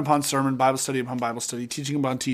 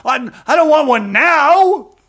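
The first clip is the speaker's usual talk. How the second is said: high, loud, slow sulky whine; huffy; 11 semitones higher, 9 dB louder, slower than usual. Retold or imitated. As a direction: sulk like a slighted child. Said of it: "He's huffy".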